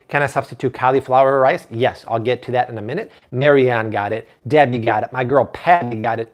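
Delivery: usual speaking voice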